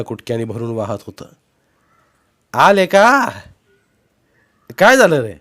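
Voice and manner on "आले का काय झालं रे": loud, higher call across a distance. Acted. Wife calling out to the arriving neighbour, loud. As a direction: shout the question to someone coming up.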